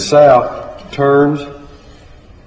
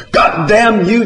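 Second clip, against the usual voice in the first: high, forceful scream of a curse; 8 semitones higher, 4 dB louder than usual. A narrator's reading. John cursing as the pig squeals, screamed. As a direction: scream it in panic. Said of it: "John screamed".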